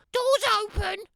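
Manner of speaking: a high, prim granny calling through the door; old granny voice. Acted. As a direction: call out like a frail grandma.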